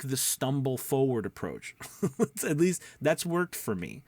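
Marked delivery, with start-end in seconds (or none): 1.8-2.4 quick high chuckle; a brief amused laugh; short laugh. Real, not acted. Laughing at his own phrase, a quick chuckle. Laughs at stumble forward approach.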